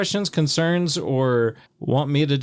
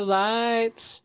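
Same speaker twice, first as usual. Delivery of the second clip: high announcer voice; 10 semitones higher than usual. Acted. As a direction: jump up high on highlights. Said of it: "I'm not sure why I said it that way".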